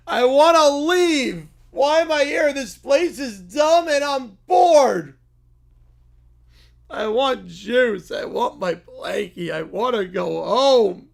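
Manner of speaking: put-on high, slow, whining toddler bear; whiny little kid. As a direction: whine like a tired child who wants his mommy.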